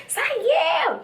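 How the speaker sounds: high mock wail; imitated crying. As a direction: imitate a drunk friend wailing for him. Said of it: "she's, like, crying".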